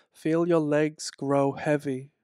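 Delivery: normal talking voice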